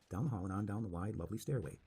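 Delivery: usual speaking voice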